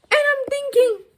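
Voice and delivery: high, loud disbelief; what the hell, said in shocked outrage; exasperated at the clerk, high and loud; incredulous. Retold or imitated. Exasperated retelling at Home Affairs.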